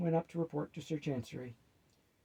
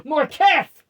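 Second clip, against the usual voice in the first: sharp warning shout, much higher and louder; 12 semitones higher, 18 dB louder than usual. Acted. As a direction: yell his name to stop him. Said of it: "shouted Robert and Mama".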